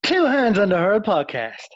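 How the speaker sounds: high, loud chant; hyped podcast intro yell; shouted show catchphrase. Acted. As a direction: call the slogan out with energy.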